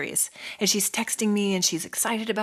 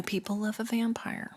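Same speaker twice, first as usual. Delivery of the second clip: softer, whispered knowing remark; whispered aside; 2 semitones higher, 8 dB quieter than usual. Real, not acted. Telling her co-host about vampire fans in a whispered aside. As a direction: let it slip out like a secret.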